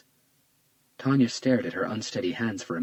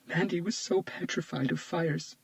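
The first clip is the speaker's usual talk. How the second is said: tearful; 10 semitones higher than usual. Acted. Tearful friend recalls victim.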